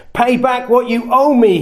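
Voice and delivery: a demand pitched high, with a touch of force; mock stern demand from a creditor. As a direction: press the debtor to pay up now. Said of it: "he demanded".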